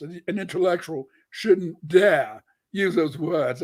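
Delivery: slow, prim mock Cambridge British accent. Mocking how an intellectual should talk, in a mock British accent. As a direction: put on a mock posh British accent, slowly. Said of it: "I have to be British in my British accent from Cambridge".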